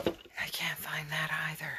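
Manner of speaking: unvoiced muttered aside; whisper. Real, not acted. Vlogger still hunting her gear, whispering. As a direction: breathe it out, barely there. Searching at night, mom asleep.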